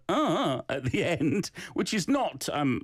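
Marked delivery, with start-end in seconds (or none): 0.1-0.6 higher-pitched "uh" mimicking a student's ending; imitated trailing "uh". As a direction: tack on a raised "uh". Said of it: "You got a little bit of the".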